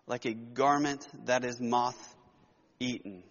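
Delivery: hushed solemn scripture read. Acted. Reads scripture passage aloud.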